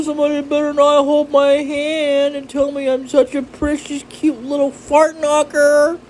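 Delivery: high, squeaky mock complaint; cartoonish high-pitched whining protest; silly put-on voice. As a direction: play it high and silly, unlike your own voice.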